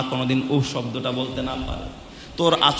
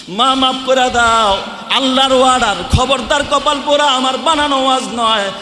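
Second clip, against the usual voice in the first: high, loud sermon voice; preaching; 10 semitones higher, 5 dB louder than usual. Acted. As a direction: drive it home from the pulpit. Preacher rising back to full force.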